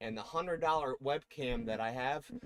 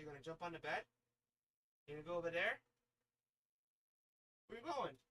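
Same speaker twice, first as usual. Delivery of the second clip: usual speaking voice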